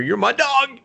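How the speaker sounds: high, exuberant cry to a buddy; fervent retold declaration. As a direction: call it out high, like a devoted friend. Retold friend's emotional declaration.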